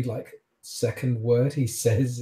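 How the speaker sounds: usual speaking voice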